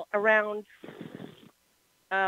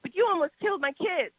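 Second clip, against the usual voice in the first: higher, pleading through tears; tearful pleading; 8 semitones higher than usual. Retold or imitated. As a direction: plead with him, near tears. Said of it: "I was just, like, hyperventilating".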